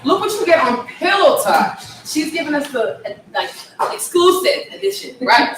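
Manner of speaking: high, bright-eyed excitement with a laugh; delighted host gushing, laughing; excited. Real, not acted. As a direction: gush over the surprise. Host delights in exclusive live performance.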